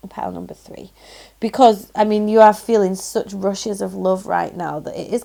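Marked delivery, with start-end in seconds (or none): 0.1-0.9 soft, breathy whisper of the pile name; whispering the pile number to the viewers; whispered aside. Real, not acted.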